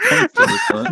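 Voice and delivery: words squeezed out high and fast through laughter; a fast tearful laugh while giving a point. Real, not acted. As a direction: talk while still laughing. Laughing at the poem.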